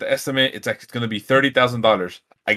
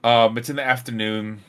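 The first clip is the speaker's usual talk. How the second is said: normal talking voice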